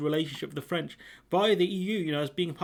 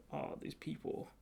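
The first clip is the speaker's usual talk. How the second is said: exasperated whisper; 15 dB quieter, slower than usual. Real, not acted. Exasperated aside about the man.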